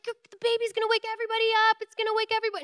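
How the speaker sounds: high, fast, frantic landlady impression; freaking-out landlady. Landlady scolding tenants over a baby, freaking out. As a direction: rattle it off in a high panicky voice. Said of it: "And she's freaking out".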